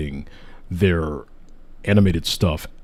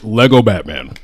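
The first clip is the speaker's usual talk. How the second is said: ordinary talk, nothing marked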